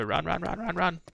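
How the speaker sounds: high urgent panicky run run; being chased. Real, not acted. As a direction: squeal it out while fleeing. Being chased by police in game.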